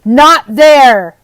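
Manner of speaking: loud bellow. Acted. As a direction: roar it to stop her. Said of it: "bellowed the woodcarver".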